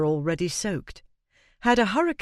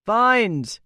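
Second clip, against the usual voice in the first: calling out; 5 semitones higher, 6 dB louder than usual. A narrator's reading. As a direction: call the name out loud across the road. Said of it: "he called".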